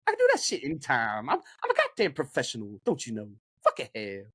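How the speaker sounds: a hint of a West Coast gangbanger; Leroy voice. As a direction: swagger it like a street tough. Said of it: "can I hear the voice?".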